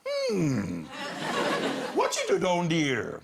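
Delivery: imitated accented mother grilling her son; disbelieving mum, pitch swooping on the questions; suspicious mum. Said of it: "Mum was standing in the front door".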